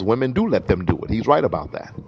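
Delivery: normal talking voice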